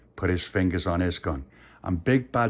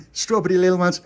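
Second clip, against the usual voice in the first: usual speaking voice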